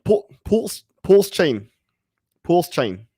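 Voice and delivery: mock Ivan on Tech's Dutch accent saying Pulse Chain. Asked by chat to do Ivan on Tech, a light Dutch accent. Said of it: "Ivan on Tech's accent".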